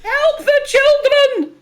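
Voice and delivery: high, loud desperate plea; distressed villager. Acted. Villager woman crying out to travellers, high and desperate. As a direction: cry out to passersby for the children.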